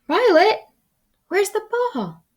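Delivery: very high calling voice; high, anxious call. Acted. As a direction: call for the dog from afar. Offstage voice calling dog.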